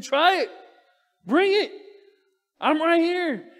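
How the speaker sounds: loud, high, daring them to come at him; defiant taunt. Acted. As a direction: shout the dare up high and fearless. Paul defiantly taunts his attackers.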